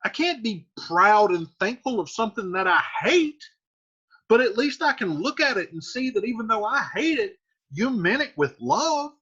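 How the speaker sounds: daughter paraphrase. Retold or imitated. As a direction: explain it like Juliet would. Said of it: "So she's like".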